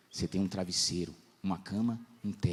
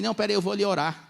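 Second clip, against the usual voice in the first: higher, quick, busy mom voice; the son playfully imitates his mom heading off to pray; imitating mom; 10 semitones higher, 5 dB louder, faster than usual. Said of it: "a minha mãe".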